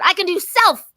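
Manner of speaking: put-on high, loud toddler insisting; little girl refusing help; stubborn little girl. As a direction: stamp it out like a defiant child. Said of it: "I said".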